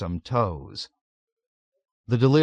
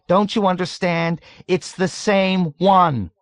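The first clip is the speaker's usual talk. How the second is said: emphatic shout; 9 semitones higher, 5 dB louder than usual. A narrator's reading. Dunbar making him understand, shouting emphatically. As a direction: hammer it home above the noise. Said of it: "shouted at him emphatically".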